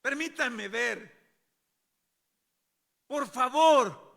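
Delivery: high, slow cry rising to a shout; afflicted pleading. Acted. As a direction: beg loudly, in anguish. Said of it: "un poco afligidos y tristes". (súplica a gritos, afligida)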